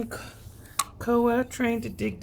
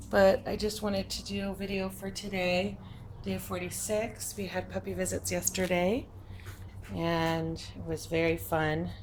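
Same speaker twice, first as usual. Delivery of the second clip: ordinary delivery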